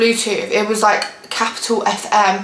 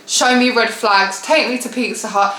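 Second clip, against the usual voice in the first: ordinary delivery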